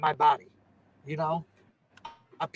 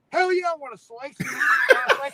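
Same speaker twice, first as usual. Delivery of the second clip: higher and louder, eager mock enthusiasm, breaking into a laugh; eager excitement; 9 semitones higher, 6 dB louder than usual. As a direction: jump at the offer of cake, laughing. Retelling eager cake moment.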